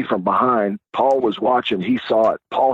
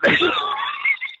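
high-pitched burst of laughter. Retold or imitated. Laughing at Paul stuck outside, high cackle. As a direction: cackle at him. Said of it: "I'm laughing at him".

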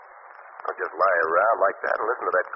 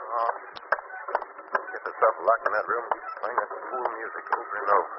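normal talking voice

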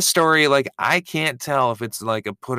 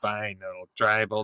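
ordinary delivery

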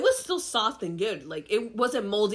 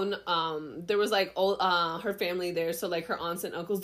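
usual speaking voice

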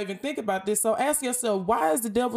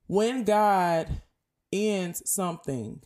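ordinary talk

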